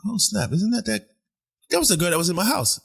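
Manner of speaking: high, fast startled exclamation; surprised recognition. Retold or imitated. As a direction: caught off guard, blurting it out. Spotting the girl on the train.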